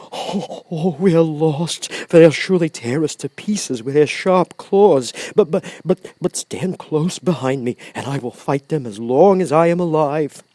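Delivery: a scared lion bracing to fight; frightened lion. A narrator's reading.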